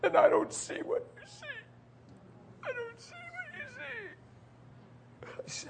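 tiny high sobbing voice; desperate crying. Retold or imitated. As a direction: imitate a boy sobbing into his dad's chest. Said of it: "he cried desperately".

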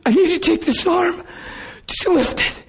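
put-on high, strained plea to his kids; high-pitched retold plea; high pleading. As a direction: beg them gently in a high voice. Retells instructing kids in pain.

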